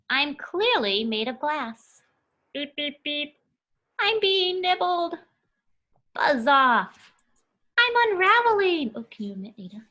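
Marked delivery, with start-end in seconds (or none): 2.5-3.4 light robotic beeping, a bit higher; a softly played robot noise; soft robot beeps. Robot sounds in book. 4.0-8.9 dramatic storybook cries. Acted. Reading characters' exclamations.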